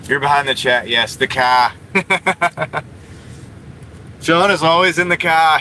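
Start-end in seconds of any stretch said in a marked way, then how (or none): 1.1-1.7 "car" said Boston style as "kah". Retold or imitated. Streamer confirms to chat it's the car, imitated Boston accent. 5.0-5.6 raised, imitated Boston "car" without the R; Shaun's Boston accent. Streamer tells chat where his friend always is, Boston accent. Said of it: "that's Sean's accent".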